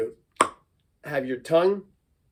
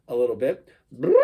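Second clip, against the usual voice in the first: ordinary delivery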